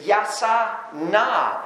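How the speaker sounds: "yasa na" said slowly, each syllable drawn out; Hebrew pronunciation. Shown as a demonstration. Preacher teaching the congregation the Hebrew word, slow careful pronunciation. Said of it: "in the Hebrew is".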